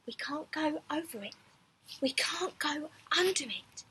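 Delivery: hushed urgent whisper. A narrator's reading. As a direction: whisper it, worried. Hushed in the snowstorm.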